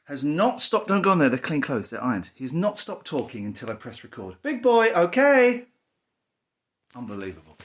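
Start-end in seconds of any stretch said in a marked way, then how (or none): 4.4-5.7 high, loud sing-song call; a slow sing-song call to the dog. Real, not acted. Calling to the dog.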